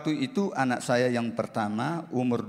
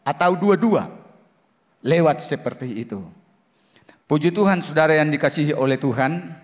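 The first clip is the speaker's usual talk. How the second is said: louder, rising sermon build; fervent preaching; 4 dB louder than usual. Acted. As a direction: preach it out with growing fire. Testimony rises to preaching.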